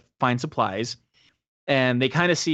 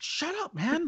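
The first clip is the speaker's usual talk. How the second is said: hushing; 8 dB quieter, slower than usual. Retold or imitated. Craig hushes Jay.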